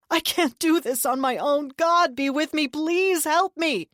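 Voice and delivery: high, loud, slowing plea; panicked prayer. A narrator's reading. Deluded brother praying frantically for help. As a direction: pray in desperate fear.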